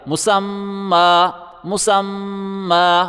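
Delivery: مسمى drawn out slowly with a long final a; pausal form. Shown as a demonstration. Teacher showing how the word is read at a pause, slowly. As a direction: stop on مسمى, hold the doubled م and end on a long a. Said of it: "اس طرح پڑھا جائے گا".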